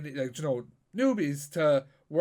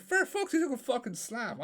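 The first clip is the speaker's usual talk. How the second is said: higher-pitched burst of admiration; retold admiration, pitched up in amazement; impressed reaction; 8 semitones higher than usual. Retelling to a friend how they applauded a slam, impressed.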